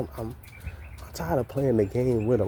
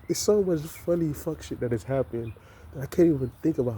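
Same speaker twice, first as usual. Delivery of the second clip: usual speaking voice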